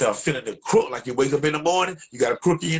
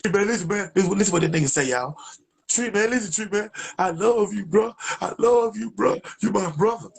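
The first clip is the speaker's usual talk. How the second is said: begging friend; 8 semitones higher than usual. Retold or imitated. Friend on the phone begging Tree, high and pleading.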